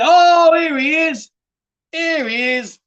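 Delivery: high, loud shout easing into a high cheer; excited greeting. Real, not acted. Streamer spotting his missing mate in chat, excited greeting. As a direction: announce a long-awaited arrival with a big whoop.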